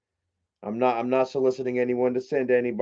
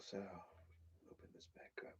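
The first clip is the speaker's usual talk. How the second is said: soft whispered aside to himself; 9 semitones lower, 34 dB quieter than usual. Real, not acted. Whispering to himself while reopening the knife. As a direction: breathe it out under the breath.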